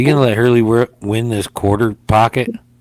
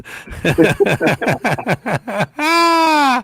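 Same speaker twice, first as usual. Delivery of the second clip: long high laugh, rapid bursts into a held note; big laugh; 11 semitones higher than usual. Real, not acted. Host cracking up at the crazy late-night banter, big high laugh.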